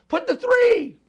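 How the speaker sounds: very high, loud mock scream; acted scream. Somebody in a change meeting screams out a fix, acted scream. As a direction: scream it out like a breakthrough. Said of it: "somebody screams".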